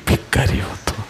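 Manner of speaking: breathy broken whisper; hushed, almost breaking. Acted. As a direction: let the voice give out to a whisper. Father's sacrifice, voice breaking.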